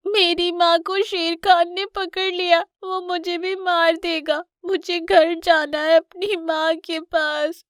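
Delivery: put-on high, slow, tearful child voice; crying cub. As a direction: pour out your trouble through tears. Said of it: "तुम क्यों रो रहे हो". (रोते हुए बच्चे की ऊँची, धीमी फ़रियाद)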